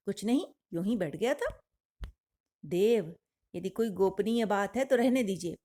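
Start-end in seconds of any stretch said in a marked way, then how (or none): none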